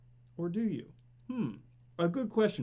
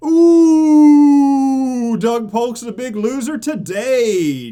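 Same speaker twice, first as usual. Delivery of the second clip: loud, high gleeful ooh, then slower; gleeful gloat; 6 semitones higher, 15 dB louder, much slower than usual. Real, not acted. Streamer gloating to chat that Doug Polk lost the bet. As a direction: relish the rival's loss.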